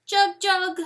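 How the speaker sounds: high, loud wailing bird cry, far off; desperate distant bird cry; crying bird call. A narrator's reading. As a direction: call out like a lonely nightingale. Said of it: "she cries from the rim".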